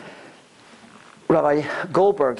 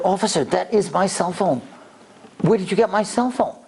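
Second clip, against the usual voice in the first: high, amazed realization; suddenly recognizing his own phone; surprised; 6 semitones higher than usual. Retold or imitated.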